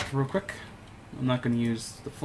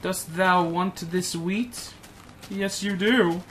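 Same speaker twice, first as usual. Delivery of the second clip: slow, higher mock-archaic offer; mock olde English; 5 semitones higher, much slower than usual. Player teasing a cow with wheat, mock grand. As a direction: play a lofty courtier to the cow.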